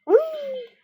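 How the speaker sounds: high delighted whee; gleeful squeal. Real, not acted. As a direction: squeal with glee. Sliding down in game.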